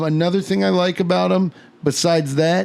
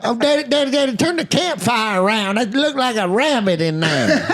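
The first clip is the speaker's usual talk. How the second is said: put-on kid voice; 7 semitones higher than usual. Kid voice quoting to daddy.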